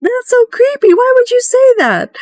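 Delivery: very high, squeaky, spooked voice; creeped-out squeal. Retold or imitated. A party member hearing of noises in the fog, high creeped-out squeal. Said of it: "And it was like".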